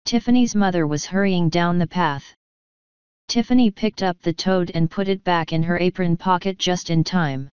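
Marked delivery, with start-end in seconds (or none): none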